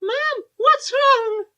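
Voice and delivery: very high and very slow, hammed up; high mock child voice. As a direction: drag it out in a squeaky mock whine. Mock reading of a worried child.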